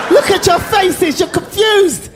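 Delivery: high, slow teasing cry; high-pitched mock astonishment at the audience; mock amazement. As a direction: tease the crowd in a high, drawn-out voice. Comedian teases surprised crowd.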